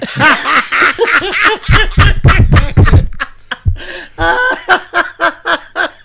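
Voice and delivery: high, loud burst of laughter; real laughter, loud and long. Real, not acted. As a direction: laugh hard at the punchline. Laughing at own joke.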